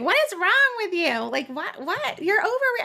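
high-pitched mock exasperation, imitating family; exasperated family. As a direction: throw up your hands at her fuss, mocking. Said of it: "And then my family's like".

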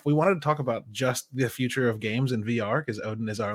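normal talking voice

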